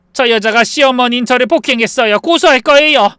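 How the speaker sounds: loud, high complaint to police; indignant accusation. Acted. Mother-in-law accuses her to police.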